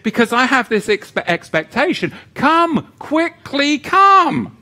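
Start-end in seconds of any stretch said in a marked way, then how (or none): 2.3-4.6 high, slow, pleading cry; fervent plea. Acted. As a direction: call out longingly. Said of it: "solemn judgment figure in my mind".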